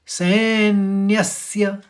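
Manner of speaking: सैन्यस्य slowly, with both स sounds clear; careful pronunciation. Shown as a demonstration. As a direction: spell out सैन्यस्य slowly, light न्य. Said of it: "उच्चारण सुनाई दे रहा होगा". (सैन्यस्य का धीमा, साफ़ उच्चारण)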